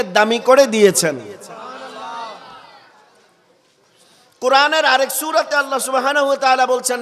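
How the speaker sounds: loud, high-pitched sermon delivery; impassioned preaching. Real, not acted. Preacher exalting the Prophet's birthplace to the crowd, impassioned. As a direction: ring it out to the whole congregation.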